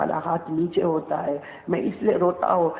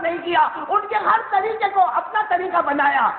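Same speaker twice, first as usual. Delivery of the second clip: preaching at full force; 11 semitones higher than usual. Acted. Preacher builds to shouting climax.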